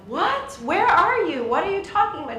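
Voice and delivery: put-on high, loud, baffled old man on the phone; Jerry. Old friend on the other line, confused and shouting. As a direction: imitate a hard-of-hearing man shouting questions. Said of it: "you could hear Jerry on the other line".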